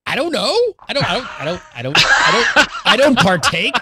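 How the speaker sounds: high stammering denial breaking into laughter; flustered laughing denial. Retold or imitated. Denying to the vet that he smokes pot, laughing and stammering.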